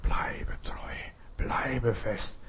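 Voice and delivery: whispered encouragement, slowly easing out of the whisper; gently instilling. Acted. Apostles to the young disciples, instilling trust in a whisper. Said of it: "zugeflößt".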